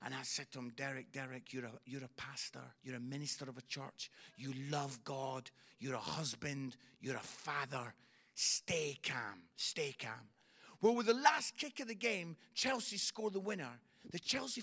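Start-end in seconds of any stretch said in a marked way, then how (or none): 0.8-10.3 low, measured talking-down voice; calming a friend. Retold or imitated. Comedian retelling talking his angry pastor friend down, calming. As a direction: soothe him in a low voice, point by point.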